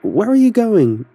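high, loud, pleading shouts; calling out. A narrator's reading. Son shouting after his silent father, loud and pleading. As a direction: stretch the last words out after him. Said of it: "I called after him".

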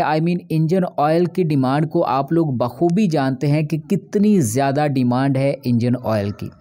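ordinary delivery

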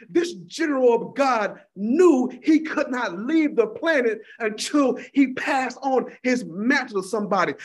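ordinary talk

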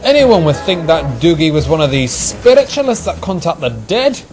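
rude teasing. A narrator's reading. Engine mocks his twin over the ghost, teasing rudely. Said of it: "teased Donald rudely".

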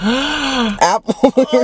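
laughing, drawn out high. Real, not acted. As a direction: laugh through the words. Laughing at apple toothpaste.

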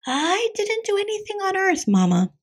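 put-on very high little kid voice; high, childlike denial; kitty boy replying. A narrator's reading. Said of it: "replied Skippy John".

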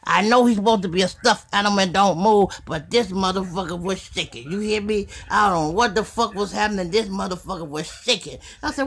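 ordinary talk, nothing marked

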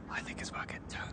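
hushed whisper. Real, not acted. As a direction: whisper so he can't hear. Hiding from the enemy.

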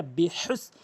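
usual speaking voice

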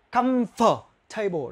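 slow "comfortable" as comf-table with no "for"; slow model pronunciation; silent for. Shown as a demonstration. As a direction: stretch "comfortable" and skip the for. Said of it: "nó là âm câm".

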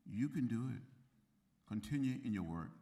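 ordinary talk, nothing marked

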